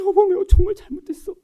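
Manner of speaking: high, pleading demonstration of remorse; acted wholehearted apology to a spouse; sincere-sounding apology. Shown as a demonstration. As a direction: plead for forgiveness as if near tears. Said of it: "그 다음에 진짜로".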